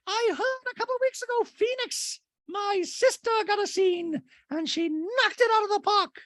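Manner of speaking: put-on very high, squeaky little-kid voice; imitating a little boy begging his mom to call; tiny child voice. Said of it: "little Jagaloo Kimsley".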